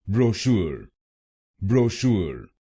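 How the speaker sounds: ब्रोशूर said as bro-SHOOR with r sounded; ब्रोशूर with American r-colored ending; American pronunciation. Shown as a demonstration. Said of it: "American English में इसको बोलेंगे". (American उच्चारण में ब्रोशूर)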